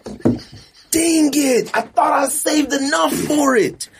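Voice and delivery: normal talking voice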